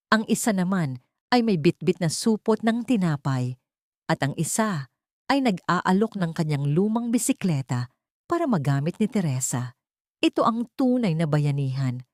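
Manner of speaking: usual speaking voice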